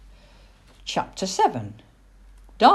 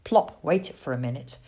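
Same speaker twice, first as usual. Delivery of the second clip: usual speaking voice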